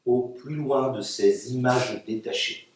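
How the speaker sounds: steady, declaimed verse in long phrases; poetry reading. Acted. Poet reading her text to an audience, steady declamation. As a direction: declaim the poem with careful articulation. Poets read their texts aloud.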